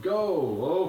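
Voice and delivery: plain reading voice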